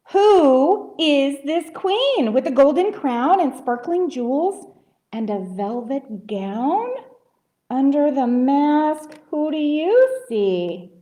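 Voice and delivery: lilting read slowing to drawn-out sing-song; rhyming story reading. A narrator's reading. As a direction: pose a riddle to children, stretching the ending. Reads rhyming story to children.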